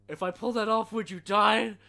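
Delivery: loud high villain impression. Bane scene dialogue.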